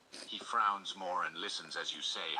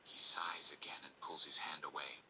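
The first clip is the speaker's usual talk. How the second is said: soft whispered storytelling; whispered narration; 2 semitones lower, 10 dB quieter than usual. A narrator's reading. Narrator telling the listener's story, whispered. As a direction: whisper the narration close to the ear.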